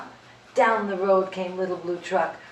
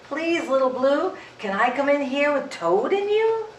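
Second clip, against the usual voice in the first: higher, pleading little animal; small pleading barnyard voice; pleading goat voice; 7 semitones higher, 3 dB louder than usual. Acted. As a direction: beg to come in out of the rain.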